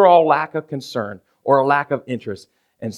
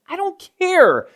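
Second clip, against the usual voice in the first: exasperated rant; 7 semitones higher, 4 dB louder than usual. Retold or imitated. Pastor retelling his fed-up reply to his wife, high and loud. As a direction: exaggerate your own fed-up retort. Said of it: "I'm like".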